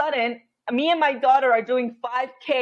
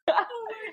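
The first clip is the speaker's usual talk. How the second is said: laugh; 10 semitones higher, 5 dB quieter than usual. Real, not acted. Laughs after the quote.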